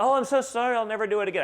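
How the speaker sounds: high, loud hammed-up groveling; mock apology. Canceled celebrities begging forgiveness, mock groveling and loud. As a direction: overdo the sorry like a fake penitent.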